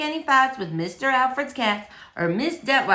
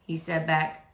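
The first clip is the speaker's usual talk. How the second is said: usual speaking voice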